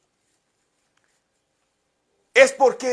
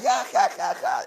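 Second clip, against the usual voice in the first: mocking laugh; 5 dB quieter than usual. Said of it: "decía".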